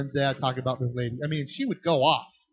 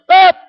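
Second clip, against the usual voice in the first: sudden loud yelled ah; tic yell; 17 dB louder than usual. Retold or imitated. Teacher imitating a boy's tic with a sharp yell. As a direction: blurt a short cry like a tic. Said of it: "he would just go".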